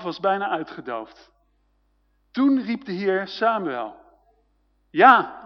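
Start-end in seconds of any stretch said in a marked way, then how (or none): 4.9-5.4 loud, quick reply to a call; answering a call. A narrator's reading. Boy Samuel answers the voice calling him at night, loud reply. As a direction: call back yes, loudly. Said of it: "antwoordde Samuel".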